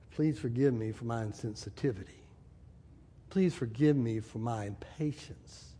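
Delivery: low, soft, measured plea; sincere apology. Acted. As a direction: ask forgiveness quietly and humbly. Models a heartfelt apology to wife.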